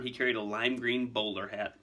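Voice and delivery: ordinary delivery, nothing marked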